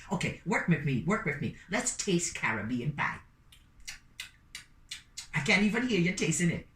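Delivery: plain reading voice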